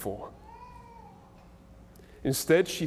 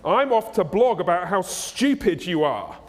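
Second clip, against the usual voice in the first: loud, high, indignant rant; hammed-up scornful tirade, hitting word after word; outraged shop owner; 6 semitones higher, 4 dB louder than usual. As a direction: throw them out in mock outrage. Acts edgy reformed repair shop owner.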